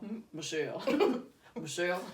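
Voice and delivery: quick impression, dropped in pitch; Obama. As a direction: say the name as an impression of a president. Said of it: "I always say it in Obama's voice".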